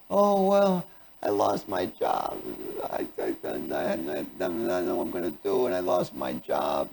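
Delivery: very low, soft, slow moan; acted grief. Grieving person explaining a lost job, acted despondent mumble. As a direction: mumble it miserably, sinking down. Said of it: "if you had someone in grief".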